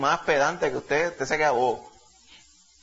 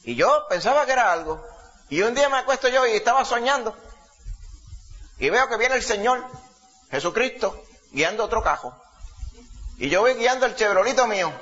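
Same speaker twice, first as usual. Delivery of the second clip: lightly raised imitation of the old man; old man's voice; 6 semitones higher than usual. Preacher retelling the old man's dream, lightly raised old man's voice. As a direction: keep the old man's high voice going as he narrates. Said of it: "hablaba con esa voz".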